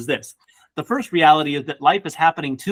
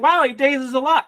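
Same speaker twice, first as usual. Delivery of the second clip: mock audience; 9 semitones higher than usual. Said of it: "you're like".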